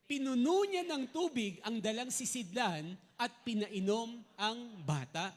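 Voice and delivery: loud, slow group reading; reading aloud together. Shown as a demonstration. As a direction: read it out strong and slow together. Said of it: "basahin ng malakas".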